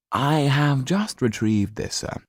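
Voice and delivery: a hint of a dutiful manservant; lightly voiced valet. Valet presenting the coat to his master, lightly voiced.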